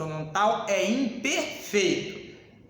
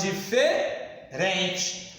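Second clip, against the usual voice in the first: very slow; 3 semitones lower, much slower than usual. Shown as a demonstration. Teacher dragging out key word.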